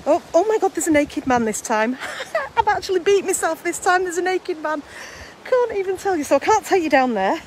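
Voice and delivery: high-pitched, giggly shock; real shocked amusement. Real, not acted. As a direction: blurt it out, astonished and amused. Startled by naked man on beach.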